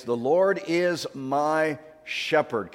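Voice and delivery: slow, measured reading of the verse; slow recitation. Shown as a demonstration. Pastor leading congregation in the verse, slow and measured. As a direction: say each word slowly. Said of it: "Can you say that with me?".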